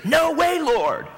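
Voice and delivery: sharp high cry of no way; mock alarmed objection; imitating Peter's protest. Peter protesting to Jesus, a sharp high cry. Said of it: "for Peter to say".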